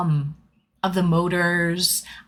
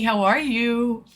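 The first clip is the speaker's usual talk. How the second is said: usual speaking voice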